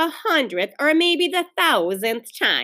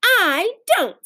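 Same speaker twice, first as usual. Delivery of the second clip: put-on high, punched child's reply; high, emphatic contradiction; defiant retort; 9 semitones higher, 3 dB louder than usual. A narrator's reading. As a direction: answer back like a stubborn child.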